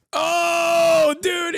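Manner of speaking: high, shouted oh dude; a shocked yell at the clip; shocked cry. Real, not acted.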